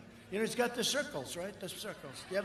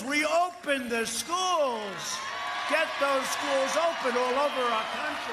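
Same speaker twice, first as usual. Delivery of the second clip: loud, higher rallying cry; rally shout; 6 semitones higher, 7 dB louder, slower than usual. Real, not acted. Speaker rouses the rally crowd about schools, loud shout.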